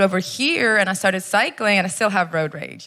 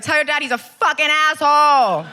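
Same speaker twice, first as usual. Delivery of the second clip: high mock-angry yell; raised-pitch road-rage outburst; retold yell; 6 semitones higher, 3 dB louder than usual. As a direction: commit fully to the re-enacted insult. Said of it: "So I actually yelled that".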